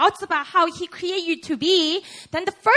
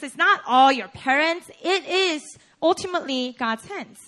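normal talking voice

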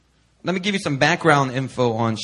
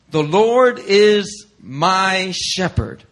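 slow and weighty, every word given room; a reverent, unhurried quote; slow solemn reading; 4 semitones higher, 4 dB louder, much slower than usual. Acted. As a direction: draw out each word of the verse. Reading the psalm's opening slowly.

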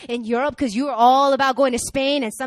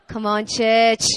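playful attempt at an Australian accent; Aussie accent; 5 dB louder, slower than usual. Shown as a demonstration. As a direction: say it like an Aussie preacher. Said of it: "That's my Aussie accent".

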